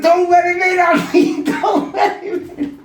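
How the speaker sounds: very high loud desperate plea, laughter at the end; panicked yell. Retold or imitated. Begging his mate to keep the knife-wielding brother out, very high panicked yell. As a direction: beg him to hold the door.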